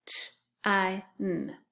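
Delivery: "T I N" as separate sounds t, long i, n; sounded out. Shown as a demonstration. Teacher tapping the first syllable, "T I N" as isolated sounds. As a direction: tap out "T I N" sound by sound. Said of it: "So the first syllable is tine".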